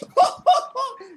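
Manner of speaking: high-pitched mock laugh; mother's gleeful laugh. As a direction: imitate a mum laughing at her own dig. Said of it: "Getting so much pleasure as well".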